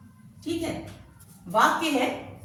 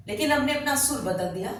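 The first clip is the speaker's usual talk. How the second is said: usual speaking voice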